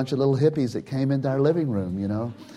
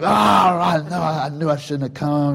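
dismayed cry; 4 semitones higher, 4 dB louder than usual. Retold or imitated. Coffee spills on him in van.

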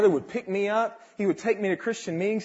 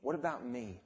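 inner voice; 5 semitones lower, 11 dB quieter than usual. Retold or imitated. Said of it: "this began to stir in me".

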